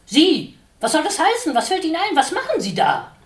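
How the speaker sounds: loud, high indignant outburst; furious housewife bird scolding; angry scolding. A narrator's reading. Watscheline scolds the intruding sparrow, high and shrill-loud.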